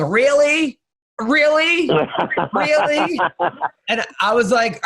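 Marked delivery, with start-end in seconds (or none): 0.0-3.2 high mock incredulous "Really?", each one pitched up; imitating a heckler's high, disbelieving "Really?". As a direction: repeat "Really?" in a high doubting voice like a heckling lady. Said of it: "kept going".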